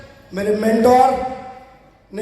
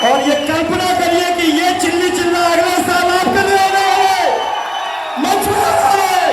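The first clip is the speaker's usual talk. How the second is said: high, sustained rallying cry over applause; hyped-up shout; 7 semitones higher than usual. Real, not acted. Trainer promising the crowd their moment on stage, hyped-up shout. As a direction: sell the big moment to a roaring hall.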